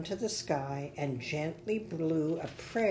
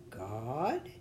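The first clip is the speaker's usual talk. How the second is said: low, hushed questioning with a rise; a touch of awed surprise, rising; gasped; 4 semitones lower, 4 dB quieter than usual. A narrator's reading. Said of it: "Billy Bubble gasped".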